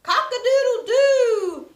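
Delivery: loud high cock-a-doodle-doo; rooster crow. Acted. Teacher crowing as the barn rooster for children, loud and high.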